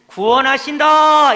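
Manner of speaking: slow, loud, ringing announcement; proclaiming salvation. Acted. Preacher voicing the prophets' promise to the church. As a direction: proclaim it as good news to all. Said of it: "이렇게 선포하는 거예요".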